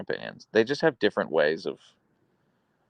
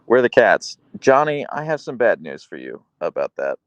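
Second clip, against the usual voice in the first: usual speaking voice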